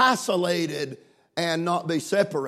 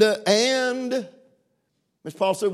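ordinary talk, nothing marked

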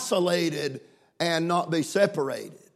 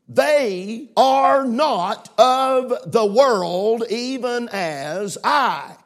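slow emphatic reading; 4 semitones higher, 5 dB louder, much slower than usual. Shown as a demonstration. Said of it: "You're to mark this verse".

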